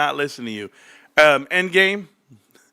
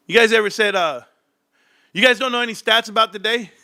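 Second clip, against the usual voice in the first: ordinary delivery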